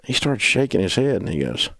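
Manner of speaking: ordinary talk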